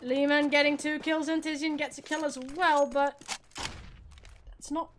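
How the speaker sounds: brisk esports caster calling the round; live play-by-play. Acted. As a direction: call the action as it happens. Casting the round.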